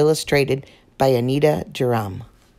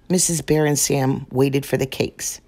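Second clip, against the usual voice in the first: ordinary delivery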